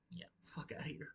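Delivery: a hushed whisper telling himself off; whispered self-scold. Real, not acted. Host scolding himself for the mangled name, whispered. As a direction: whisper it under your breath.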